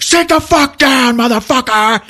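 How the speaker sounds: high, loud, drawn-out shout; hammed-up menacing yell; acted furious command. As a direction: roar the order at someone standing up. Intruder orders husband down.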